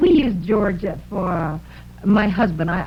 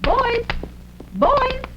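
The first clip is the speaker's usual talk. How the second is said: calling mother voice; 10 semitones higher than usual. Pretend.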